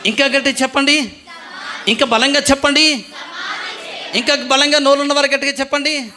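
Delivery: rousing call. Real, not acted. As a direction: whip the crowd up to shout. Urging congregation to answer louder.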